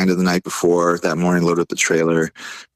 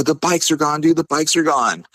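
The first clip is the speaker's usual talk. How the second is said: higher-pitched, urgent repeat; retold panic; 9 semitones higher than usual. Driver repeats the loss to his passenger, retold panic. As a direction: insist on it in mock alarm.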